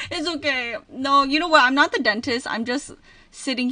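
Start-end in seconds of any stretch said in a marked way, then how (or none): none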